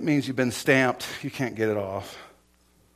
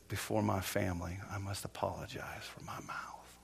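low and soft, fading to near whisper; hushed, sinking confession; retold humble apology; 4 semitones lower, 11 dB quieter than usual. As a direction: quietly confess it, imitating the man. Retells a man's public apology.

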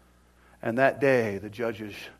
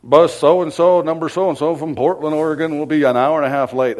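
loudspeaker announcement; 2 semitones higher, 12 dB louder than usual. Retold or imitated. Station loudspeaker announcing the late Portland bus, PA voice. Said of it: "over the loudspeaker".

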